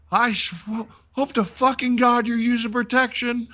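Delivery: high mock yell of a drill sergeant; hammed-up drill sergeant shout. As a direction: bark it like an outraged drill sergeant. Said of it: "he started screaming and yelling at him".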